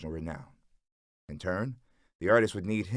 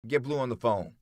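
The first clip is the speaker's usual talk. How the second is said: narrated holler, higher pitched; raised, insistent voice; 5 semitones higher, 4 dB louder than usual. A narrator's reading. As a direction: call out an order across the room. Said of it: "Meech hollers".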